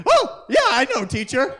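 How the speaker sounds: put-on very high, bright eager child; eager schoolkid. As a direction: chirp it like the teacher's pet. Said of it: "they were always like".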